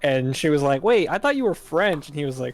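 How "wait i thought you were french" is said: higher, louder mock surprise; surprised retelling. Friend confronts the fake French student, mock surprise. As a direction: catch him out, lightly incredulous.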